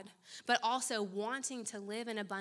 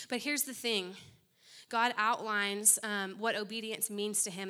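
ordinary talk

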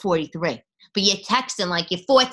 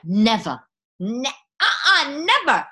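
NEVER hit loud and rising; firm never, never; emphatic never; 6 semitones higher, 7 dB louder, slower than usual. Real, not acted. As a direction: insist on never, louder each time. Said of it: "emphatic refusal".

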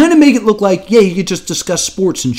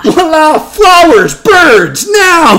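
shouted magician flourish; 11 semitones higher, 6 dB louder, slower than usual. Pretend.

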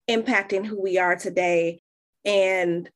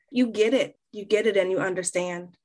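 normal talking voice

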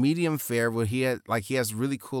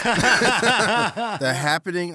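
laughing; 9 semitones higher, 6 dB louder, much slower than usual. Real, not acted. Laughing naming a bad movie.

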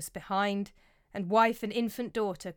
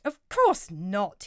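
a mild, slow, louder snap; snapping; 6 dB louder, much slower than usual. Acted. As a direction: cut him off, slow and curt. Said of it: "he snaps".

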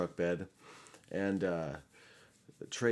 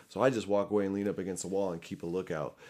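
ordinary delivery

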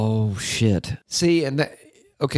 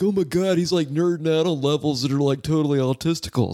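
higher-pitched imitation of a scoffing listener; mock exasperated reaction; 6 semitones higher than usual. Imagining a listener's reaction to music nerdery, mock exasperated. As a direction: mimic someone appalled by nerdy talk, pitched up. Voices someone reacting to nerdy talk.

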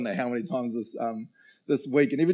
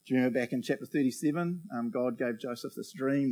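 usual speaking voice

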